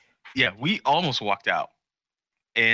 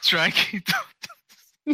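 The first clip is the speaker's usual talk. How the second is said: high silly voice; much slower than usual. Pretend. Joking mock voice.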